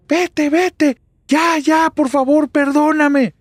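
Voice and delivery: very high, loud screamed plea; terrified screaming. Acted. Mother on edge as the door opens, screaming in terror. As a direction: scream and beg it to go away. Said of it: "comienza a gritar y empieza a decir".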